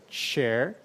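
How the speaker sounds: "chair" drawn out slowly with the t clearly heard; slow pronunciation demo. Shown as a demonstration. Teacher shows the little t in chair, slow. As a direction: stretch "chair" so the little t stands out. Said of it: "E tem um Tzinho".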